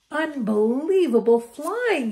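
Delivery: high, loud and slow praise; excited. Acted. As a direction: gush with delight.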